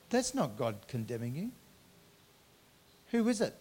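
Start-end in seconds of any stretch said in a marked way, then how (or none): none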